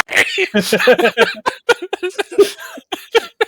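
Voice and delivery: loud laughing shout. Real, not acted. Laughing at own joke.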